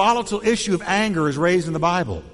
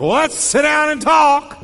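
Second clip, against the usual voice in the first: much higher and louder, shouting; shouted invitation; 10 semitones higher, 6 dB louder than usual. Shown as a demonstration. Pastor demonstrates the wrong volume to the congregation, shouting. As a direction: yell the calm words angrily. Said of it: "the volume you use".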